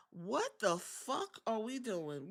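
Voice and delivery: ordinary delivery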